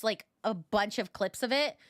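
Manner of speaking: ordinary delivery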